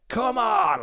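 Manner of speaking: cheer. Retold or imitated. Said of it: "I was like".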